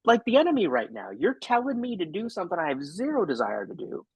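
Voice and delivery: put-on high, pleading child's whine; imitating a defiant little kid; whiny kid voice. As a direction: play the kid who won't go to bed. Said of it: "the kid is just like".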